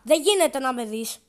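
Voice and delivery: ordinary talk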